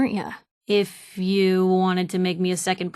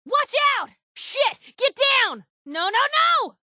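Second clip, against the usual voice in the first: high urgent shouted warning; 13 semitones higher than usual. Acted. Companion warning Blue of gunfire, urgent high shouts. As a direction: yell it to get her down.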